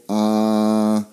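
long, level "ah" held on one pitch; open-mouth "ah". Shown as a demonstration. Patient asked to open wide, open-mouth "ah". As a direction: say a sustained, flat ah for the dentist. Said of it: "open your mouth and go".

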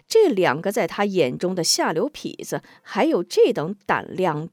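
ordinary delivery, nothing marked

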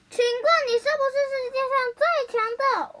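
high, loud, rapid question; urgent yelled query; loud shouting. A narrator's reading. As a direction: shout up to a cloud. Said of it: "乌云大声叫说".

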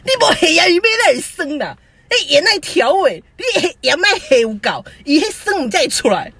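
high, loud mock outburst of mom; a hammed-up burst of temper; mini outburst. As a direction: imitate mom snapping in a sudden little rage. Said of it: "但是小暴怒这样说".

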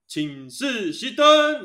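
drawn-out, high, loud barked call; army lights-out call. Retold or imitated. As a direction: call it out slowly like a duty officer at lights-out. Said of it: "一模一样". (学台副拉长声音大喊请示熄灯)

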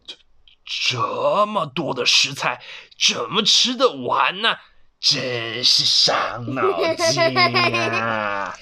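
put-on slow, drawn-out old grandpa fretting; stretched-out mock dismay; overwhelmed grandpa. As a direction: puzzle over it in a slow character voice.

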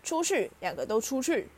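sharp order. A narrator's reading. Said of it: "德斯里姨丈沉着声气说".

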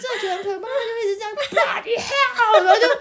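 high laughing, nearly crying; laughing to tears. Real, not acted. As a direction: crack up until the voice breaks. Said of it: "laughing to tears". (笑到快哭，声音很高)

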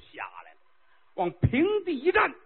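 ordinary talk, nothing marked

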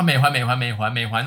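mock the pundit's piercing call; imitating a political pundit calling out; imitating Zhou Yukou. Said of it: "周玉蔻这种就是她".

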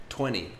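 "Twenty" as "twenny", the T dropped; twenty without T. Shown as a demonstration. Teacher modeling "Twenty" for students. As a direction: drop the T: "twenny". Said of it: "listen to how I say it".

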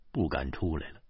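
plain reading voice

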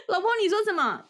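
high, loud yell outward; loud call out. Retold or imitated. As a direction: shout to fake calm. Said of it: "往外大喊说".

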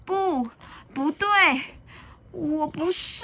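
high, halting little-dinosaur voice; high, plaintive childish protest; distressed denial. A narrator's reading. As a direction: refuse to believe it, word by word.

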